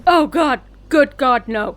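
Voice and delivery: loud, appalled oh God; horrified exclamation. Acted. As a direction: cry out in disgust at the thought. Horrified denial of liking Bruno.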